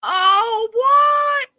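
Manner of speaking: high, loud cry of disbelief; shocked outcry. Real, not acted. Reacting to a friend's lost accent, shocked cry. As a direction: shriek out oh what.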